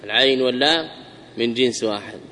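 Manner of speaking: usual speaking voice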